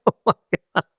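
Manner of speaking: quick words through a laugh; cracking up at his own bit; laughing it out, high and fast. Real, not acted.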